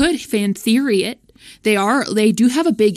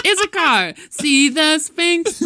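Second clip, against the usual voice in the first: high, hammed-up proclamation, slowing at the end; theatrical stage line; 7 semitones higher, 3 dB louder, slower than usual. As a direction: proclaim it like a bit-part actor. Acts out character's only line.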